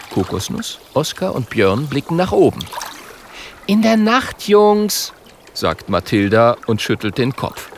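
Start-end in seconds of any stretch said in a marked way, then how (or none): 3.7-5.1 high, chiding character voice; high-pitched little know-it-all; know-it-all girl figure. A narrator's reading. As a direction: correct the boys in a high voice.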